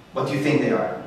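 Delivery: normal talking voice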